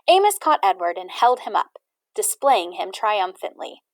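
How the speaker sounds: usual speaking voice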